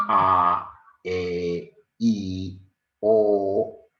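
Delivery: each vowel a, e, i, o said clearly apart; vowel demo. Shown as a demonstration. Teacher sounding out a, e, i, o for pupils. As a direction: sound out a, e, i, o one by one. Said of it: "Si yo digo, por ejemplo".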